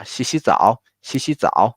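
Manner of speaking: 洗洗澡 as one unit, both 洗 rising and 澡 dipping; two-two-three tones. Shown as a demonstration. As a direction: lift 洗洗 high, then drop into 澡. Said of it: "we just do two, two, three".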